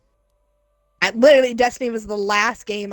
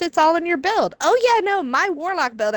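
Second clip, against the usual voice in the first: mock gamer voice; 8 semitones higher than usual. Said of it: "retold panelist".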